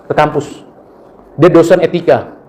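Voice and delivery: ordinary talk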